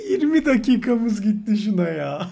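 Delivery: words pushed out through laughter; laughing in exasperation while talking; frustrated laugh. Real, not acted. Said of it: "sinirden gülüyorum".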